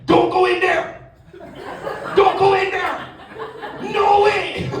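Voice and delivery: put-on very high Caribbean-accented yell; excited, high-pitched Caribbean heckling; Caribbean moviegoer. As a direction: holler at the screen in a Caribbean accent. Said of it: "in the Caribbean, they're like".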